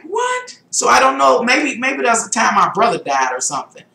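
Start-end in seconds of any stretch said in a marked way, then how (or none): 0.0-0.6 much higher, stunned; can't believe it's been weeks; shocked. Retold or imitated. Realizing weeks without eating.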